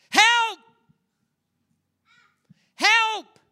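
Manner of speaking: high, very loud full-force scream; all-out high-pitched shout; desperate cry for help. Acted. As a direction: yell to a passing boat as if drowning. Acting out desperate drowning cry.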